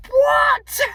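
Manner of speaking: high, loud shocked cry; shocked shout. Real, not acted. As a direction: blurt out disbelief, very high and loud. Reacting to daddy's excuse.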